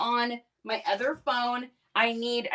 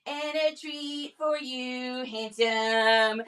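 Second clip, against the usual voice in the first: put-on slow baby talk; 3 semitones higher, much slower than usual. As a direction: coax the dog gently. Talking to dog.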